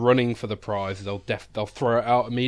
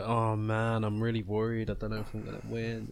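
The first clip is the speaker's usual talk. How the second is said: mock worried; 5 dB quieter than usual.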